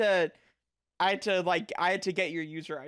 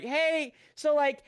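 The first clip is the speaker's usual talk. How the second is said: polite phone voice; 6 semitones higher than usual. Retold or imitated. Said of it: "be like".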